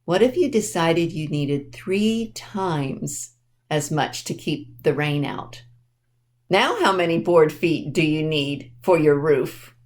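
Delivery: ordinary delivery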